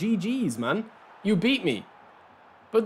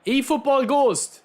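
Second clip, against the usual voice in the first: ordinary talk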